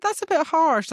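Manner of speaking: high, squeaky fake shock; mock dismay. Retelling her own reaction to the queens, high mock dismay. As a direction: squeal it like it's an understatement. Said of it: "I was like".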